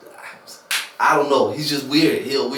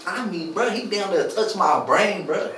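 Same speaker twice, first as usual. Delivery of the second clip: ordinary talk, nothing marked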